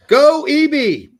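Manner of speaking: high, drawn-out cheer; cheering shout. Real, not acted. Host shouts support for E B, high drawn-out cheer. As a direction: belt it out long and loud.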